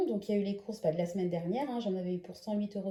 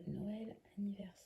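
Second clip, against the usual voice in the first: muttering quietly; 13 dB quieter than usual. Real, not acted. Reading out labels under breath.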